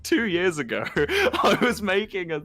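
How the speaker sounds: laughing while retelling. Real, not acted. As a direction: laugh through an embarrassing retold apology. Retells cringey apology, breaking into laughter.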